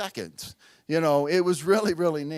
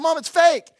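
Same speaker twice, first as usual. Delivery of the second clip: louder reveal; 9 semitones higher, 7 dB louder than usual. Retold or imitated. Revealing the prank to mom.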